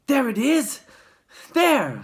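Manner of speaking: loud, high shout; excited onlooker shouting. A narrator's reading. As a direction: point and yell. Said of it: "someone else shouted".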